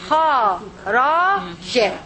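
"Kha, ra, ja" as separate sounds; spaced-out syllables for beginners; letter by letter. Shown as a demonstration. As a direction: sound out "Kha", "ra", "ja" one at a time. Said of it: "It is kha with a fatha".

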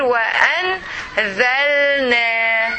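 "Wa, an, zal, na" in separate chunks, pausing between; divided into syllables. Shown as a demonstration. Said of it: "Okay, you can divide here".

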